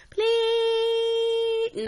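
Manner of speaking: put-on very high, drawn-out kid sister plea; begging please. Little sister pleads with big sister, whiny beg. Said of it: "my sister is nagging me the whole time".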